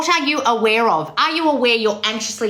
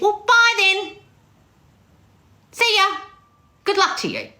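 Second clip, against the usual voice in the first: high, sing-out mock goodbye; dismissive send-off; 12 semitones higher than usual. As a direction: wave off the sensitive one in a raised voice. Mock send-off to sensitive client.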